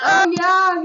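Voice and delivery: high, loud burst of delight; excited cheer. Real, not acted. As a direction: cheer loudly at the discovery. Cheering on spotting Ghana on shirt.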